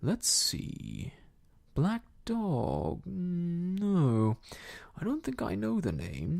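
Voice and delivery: drawn-out, swooping recollection; hammed-up pondering in a full voice; Silver musing aloud. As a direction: think aloud theatrically, stretching words as memory returns.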